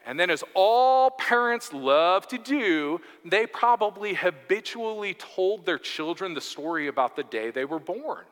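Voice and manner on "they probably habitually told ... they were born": ordinary delivery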